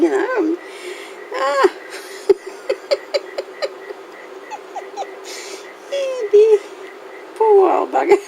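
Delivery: high, helpless laughter through the words; can't stop laughing while trying to talk; breathless giggles, near tears; giggling fit. Real, not acted. Giggle fit over glue.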